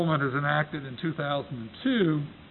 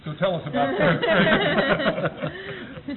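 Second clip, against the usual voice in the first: laughing; 11 semitones higher, 3 dB louder, much slower than usual. Real, not acted. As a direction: still laughing, slow and high. Laughing after his own joke.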